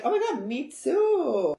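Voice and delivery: high, slow gush; high, drawn-out fan gush; gushing squeal. Retold or imitated. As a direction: gush it like a superfan. Same drink as [name].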